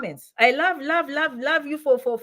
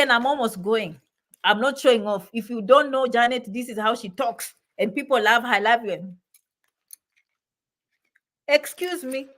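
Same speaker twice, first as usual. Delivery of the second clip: normal talking voice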